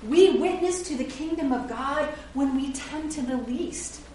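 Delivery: ordinary delivery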